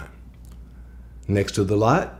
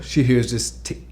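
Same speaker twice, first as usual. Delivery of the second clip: ordinary talk, nothing marked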